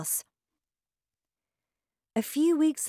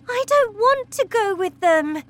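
much higher, louder outburst; girl shouting to her teacher; sudden shout; 8 dB louder than usual. Acted. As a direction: burst out in protest. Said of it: "Matilda shouted suddenly".

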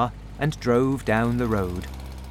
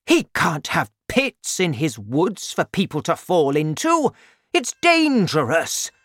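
angry; 4 semitones higher, 3 dB louder than usual. A narrator's reading. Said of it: "jumping up and down angrily".

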